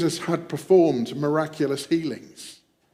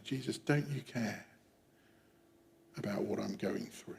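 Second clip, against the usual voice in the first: soft, subdued question in prayer; private plea; 4 semitones lower, 13 dB quieter than usual. Acted. Believers getting it wrong, asking Jesus, quiet private plea. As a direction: ask it very quietly, falling away low. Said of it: "we sometimes say".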